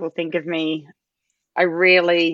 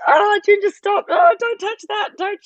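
anxious mum; 13 semitones higher than usual. Retold or imitated. Mother fretting at her daughter, high and urgent. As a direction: fuss at the child to stop touching things. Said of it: "you're like".

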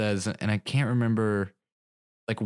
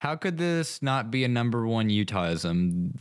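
usual speaking voice

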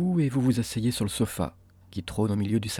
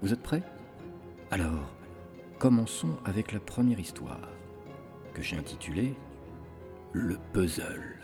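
horror host; 5 dB quieter than usual. Acted. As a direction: welcome them into the crypt, ominously. Horror podcast host intro.

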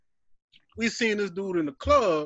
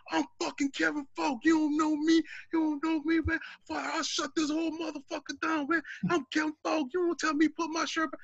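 high, blustering boast of a puffed-up athlete; fake tough guy swagger; 8 semitones higher, 6 dB quieter than usual. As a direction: strut and brag like a big shot. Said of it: "Man, he went off".

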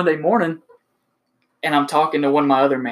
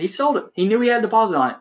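higher, fast heated accusation; angry; 7 semitones higher, faster than usual. Retold or imitated. Salesman accusing the manager in front of the GM, heated. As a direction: rattle it off, furious.

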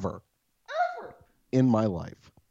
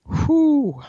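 recovery exhale; 9 dB louder than usual. Real, not acted. Recovering after choking.

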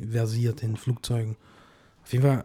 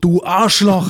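Kinski rant; 6 semitones higher, 8 dB louder, much slower than usual. Pretend. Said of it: "hat er immer gesagt".

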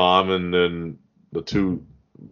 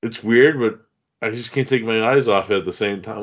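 normal talking voice

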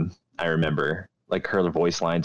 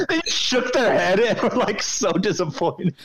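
laughing. Real, not acted. Laughing high through.

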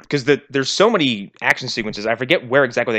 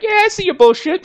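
much higher, sneering voice; hammed-up smug cop; mock smug Brant; 4 dB louder than usual. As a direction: play the smug partner who sees through him. Mocking Brant.

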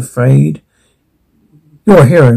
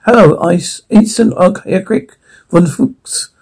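put-on German accent; 2 semitones higher, slower than usual. German doctor introduces himself.

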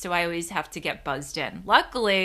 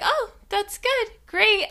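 much higher, forced brightness; fake cheerful; 14 semitones higher, 3 dB louder, slower than usual. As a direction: chirp back politely while flustered.

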